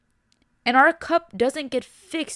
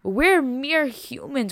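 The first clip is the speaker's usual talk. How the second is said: ordinary delivery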